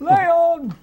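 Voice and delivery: shouted name. Retold or imitated. Quoting film's shouted name.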